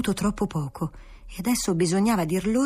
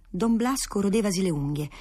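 plain reading voice

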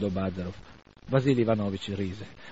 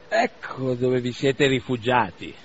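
slow, a bit louder greeting; lightly voiced old doctor; 2 semitones higher, 6 dB louder, slower than usual. Old doctor finding the young men in the hay, slow call. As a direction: call out, found them.

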